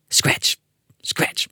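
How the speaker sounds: unvoiced scratch sound, hushed; soft, eerie scratch effect; whispered scratching. A narrator's reading. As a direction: whisper it like claws on wood. Narrator voicing scratching noise.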